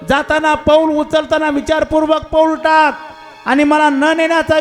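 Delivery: high, loud, defiant dialogue voices; acted God and crocodile quarrel. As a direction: argue it out full force, loud and high. (उंच, मोठ्या आवाजात वाद)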